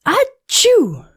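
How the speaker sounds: very high, big acted sneeze; a huge cartoon achoo; gigantic sneeze. A narrator's reading. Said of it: "The snowman sneezed, a gigantic".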